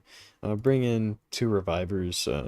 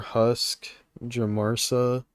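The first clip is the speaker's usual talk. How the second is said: ordinary talk, nothing marked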